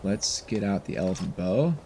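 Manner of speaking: normal talking voice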